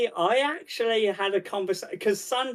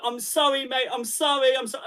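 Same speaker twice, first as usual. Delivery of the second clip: apologetic Brit; 8 semitones higher than usual. Retold or imitated. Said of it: "most fucking British thing ever".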